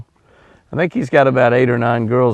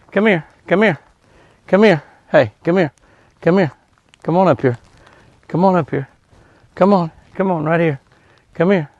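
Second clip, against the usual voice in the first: higher-pitched repeated calls to an animal; pitched-up "come on over" animal call; coaxing an animal over; 5 semitones higher than usual. Real, not acted. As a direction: call the deer over in short, coaxing bursts. Calling a deer over to him.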